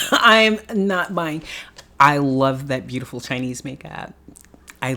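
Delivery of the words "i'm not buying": higher, slow, laughing through it; laughing refusal. Real, not acted. Laughing as she refuses to buy the brand, slow and higher. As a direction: stretch it out while laughing.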